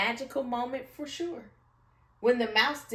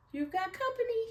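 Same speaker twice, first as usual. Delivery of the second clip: squeaky mouse voice; 14 semitones higher, faster than usual. Acted. Mouse speaks to lion.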